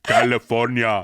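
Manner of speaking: slow Austrian-accented impression; drawn-out Austrian movie-star accent; Schwarzenegger accent. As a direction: stretch it out in a thick Austrian accent. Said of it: "I was trying to do his accent".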